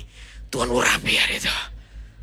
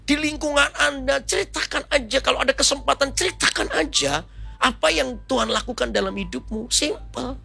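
high, projected sermon voice; preaching exhortation; 11 semitones higher than usual. Real, not acted. Preacher urges congregation to share the gospel, high exhorting voice. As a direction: urge the congregation with raised pitch.

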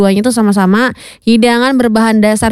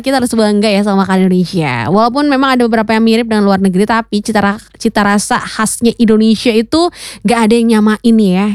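usual speaking voice

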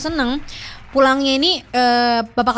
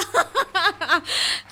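a breathy laugh of relief; relieved laugh; 4 semitones higher, 5 dB quieter than usual. Real, not acted. Laughing in relief at passing.

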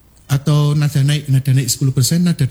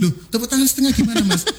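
audience confusion; 5 semitones higher, faster than usual. Retold or imitated. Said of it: "sambil bilang yes".

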